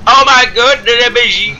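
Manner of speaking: fan d'anime aigu et surexcité; excited anime fan. Pretend. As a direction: piaille d'émerveillement comme un otaku. Fan d'anime qui s'extasie. (fan d'anime surexcité)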